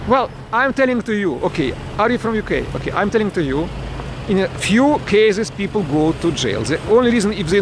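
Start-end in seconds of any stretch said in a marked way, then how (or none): none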